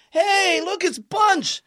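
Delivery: high, loud, called across the street; calling out. Retold or imitated. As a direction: shout to get everyone looking. Partner calling out.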